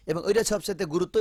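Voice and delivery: ordinary talk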